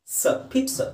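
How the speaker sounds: ಸ, ಪೀಟ್ ಸ with ts sound, no z; pron. Shown as a demonstration. Teacher shows pizza has ts not z. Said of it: "ಝ sound ಬರಬಾರ್ದು".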